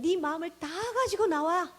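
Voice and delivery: imitating an insistent, inviting Father; God the Father urging. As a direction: urge the child to let it all out. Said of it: "아버지가 원하시는 건".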